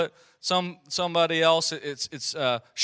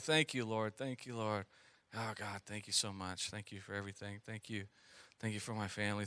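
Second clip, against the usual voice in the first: mumbled low and much softer, on autopilot; mindless droning; 8 semitones lower, 16 dB quieter than usual. Retold or imitated. Pastor mocking rote prayer habits, low mindless mumble. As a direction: rattle off thanks without meaning it. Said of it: "that tone, that mindless".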